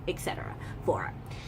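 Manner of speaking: breathy whisper tacked onto the end; whispered aside. Real, not acted. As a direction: drop to a whisper for the throwaway bit. Trailing aside.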